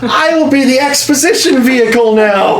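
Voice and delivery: put-on high, shrill-free child voice, very high; overeager. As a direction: overeager kid calling his parents. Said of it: "has the most overeager voice".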